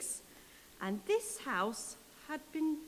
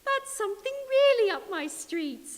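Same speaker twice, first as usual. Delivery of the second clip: much higher, louder sing-song hello; a prim lady answering the phone; 7 semitones higher, 4 dB louder than usual. Acted. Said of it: "she said".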